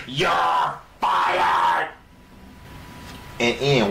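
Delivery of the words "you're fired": a touch of a boss yelling; retold firing shout. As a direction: bark it like a boss. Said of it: "He said".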